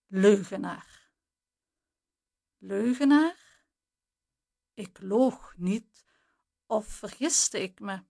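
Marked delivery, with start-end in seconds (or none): 0.1-1.1 leugenaar spat out with a firm falling end; brand him a liar, cold and final; contemptuous insult. A narrator's reading. Jana calling the narrator a liar, contemptuous falling leugenaar. Insult ending Jana's threat. 2.6-3.6 Leugenaar asked back slowly with a rising, doubting tune; incredulous echo. A narrator's reading. Narrator stunned at being called a liar, slow incredulous Leugenaar.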